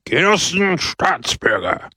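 high, ringing call to the crowd; shouted address. A narrator's reading. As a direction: hail the crowd from a balcony. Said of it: "schrie Pepone". (laute Anrede an die Menge)

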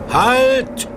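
high, loud commanding shout; powerful call; mighty command. Acted. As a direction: order the storm to stop. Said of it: "rief er mit gewaltiger Stimme".

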